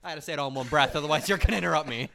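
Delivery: loud, exasperated self-defence; loud protest. Real, not acted. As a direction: raise your voice to justify yourself. Protesting being interrupted.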